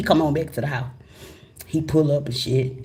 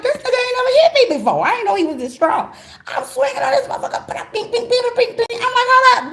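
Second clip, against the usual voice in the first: very high, frantic voice; panicked squeal; 4 dB louder than usual. Retold or imitated. Wife being choked, squealing in high panic. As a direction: squeal in disbelief.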